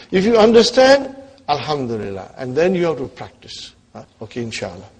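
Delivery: normal talking voice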